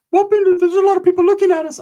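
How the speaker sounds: squeaky-high voice for a nervous sidekick; high-pitched impression of a worried friend; Ricky. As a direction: mimic him way up in falsetto. Imitates Ricky in story.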